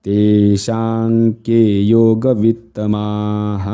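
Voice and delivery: slow, drawn-out Sanskrit chant; slow recitation. Shown as a demonstration. Teacher drills the half verse, slow sustained chant. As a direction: hold each long vowel steadily.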